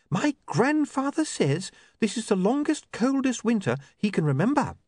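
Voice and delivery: put-on earnest girl passing on news; young woman Sacharissa. A narrator's reading.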